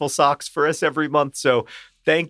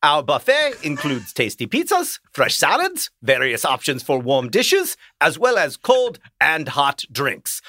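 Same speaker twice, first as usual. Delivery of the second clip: put-on German accent; 3 semitones higher than usual. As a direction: read the ad copy as a theatrical mock German. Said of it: "this is a German accent".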